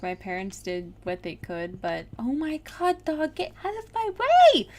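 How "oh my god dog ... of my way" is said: rising to a loud, high shout; exasperated yell. Real, not acted. Player yelling at dog blocking the way, exasperated. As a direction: burst out in real exasperation, pitch climbing.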